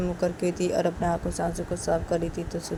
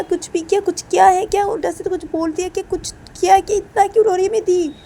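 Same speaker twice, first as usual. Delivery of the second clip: much higher, much louder agitated questions; agitated demanding; 11 semitones higher, 7 dB louder than usual. Acted. Rahul demands on the phone why his sister cries, loud and agitated. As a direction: grill him over the phone, upset. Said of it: "Rahul बोलते कि".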